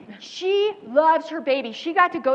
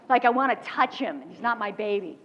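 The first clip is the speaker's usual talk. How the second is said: ordinary delivery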